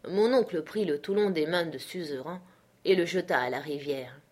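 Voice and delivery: ordinary delivery, nothing marked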